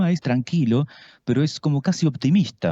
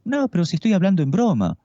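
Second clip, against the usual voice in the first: light, higher teasing voice; playful joking tone; 8 semitones higher than usual. Shown as a demonstration. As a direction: say it as if only joking. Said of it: "tiene que decirle al otro".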